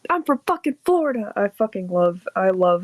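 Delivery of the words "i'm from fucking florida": high, fast character voice; quoting a character. Retold or imitated. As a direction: rattle it off in a cartoon voice. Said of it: "That's the best quote in the show".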